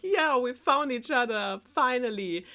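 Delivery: high-pitched, playfully acted relief; excited princess reply. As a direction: gush back as the reunited princess.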